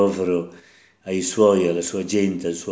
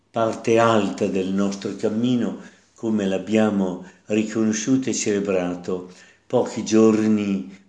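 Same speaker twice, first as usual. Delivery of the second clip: normal talking voice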